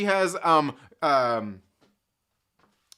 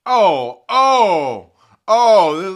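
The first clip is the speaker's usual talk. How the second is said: big drawn-out "oh", much louder; loud exclamation; 3 semitones higher, 12 dB louder, much slower than usual. Real, not acted. Host spots a viewer's cheeky comment, loud exclamation. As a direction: call out loudly at a sudden sight. Mock outrage at a chat comment.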